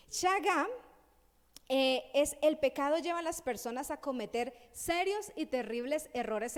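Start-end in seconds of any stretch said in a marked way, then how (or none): 0.1-0.7 shagá called out high and loud, final A stressed; pronunciation. Shown as a demonstration. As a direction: proclaim shagá, hitting the last A. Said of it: "acento en la última A".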